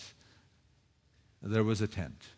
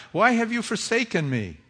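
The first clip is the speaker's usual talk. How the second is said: higher, louder anguished cry; 9 semitones higher, 7 dB louder than usual. Acted. Preacher voices Jesus crying on the cross, anguished. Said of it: "Jesus raises this cry".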